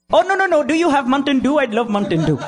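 high, eager American customer voice; excited customer. Retold or imitated. Waitress imitating a customer raving about soda, high and eager. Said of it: "il a fait comme".

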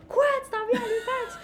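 high excited disbelief; surprised laughing. Retold or imitated. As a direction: squeal it out laughing. Friend's surprised reply, quoted.

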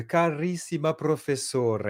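slow, sung-out Italian Carissima professore; Italian accent. Retold or imitated. Italian colleagues greeting him, slow lilting Italian accent. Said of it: "les Italiens quand ils m'écrivent".

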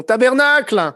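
a French tourist copying the local swear word, lightly hammed; French person swearing Québécois. French visitors who pick up Quebec slang, mock French accent. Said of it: "les Français qui viennent ici qui font".

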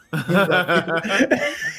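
real laugh climbing in pitch; amused laugh. Real, not acted. Storyteller cracking up at calling his kick a love tap, real laugh. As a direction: burst out laughing at your own joke.

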